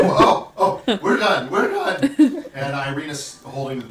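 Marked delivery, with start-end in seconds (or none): 0.0-2.4 slow, loud flustered retreat; flustered couple. Acted. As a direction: draw it out flustered. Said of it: "The man and the woman go".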